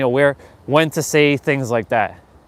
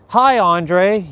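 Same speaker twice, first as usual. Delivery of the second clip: higher-pitched, mock-polite greeting; superficial small talk; 5 semitones higher, 3 dB louder than usual. Mocking boring small talk with Andre, higher polite greeting. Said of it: "they're boring, and they're monotonous".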